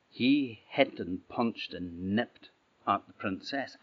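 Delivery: plain reading voice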